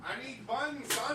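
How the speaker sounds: hammed-up owner demanding buns; mock bossy owner. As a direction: bark it out like a clueless owner. Mocking owner voice.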